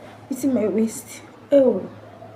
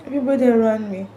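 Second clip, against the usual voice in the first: high, crying, voice breaking; weeping while telling; sobbing; 4 semitones higher than usual. Real, not acted. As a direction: speak through real tears. Grief over babies' death.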